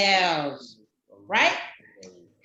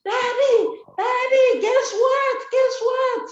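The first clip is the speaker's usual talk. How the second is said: much higher and louder, bursting with news; excited child; 12 semitones higher, 3 dB louder than usual. Acted. Proud boy calling his daddy, high and eager. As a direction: call out to daddy like a thrilled little boy.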